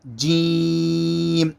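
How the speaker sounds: جیم with its long ee held very slowly on one note; pressed, stretched جیم. Shown as a demonstration. Teacher showing the madd, جیم stretched long and level. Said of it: "اس کو دبا کر پڑھنا".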